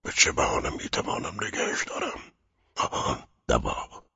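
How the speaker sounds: hushed scheming to himself; whispered inner thought. A narrator's reading. Sick man dreading being left alone, whispering. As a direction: whisper as you hunt for an excuse. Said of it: "با خود گفت".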